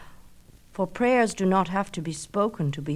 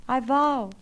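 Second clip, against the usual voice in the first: high echo; 7 semitones higher than usual. Acted. Echo repeats.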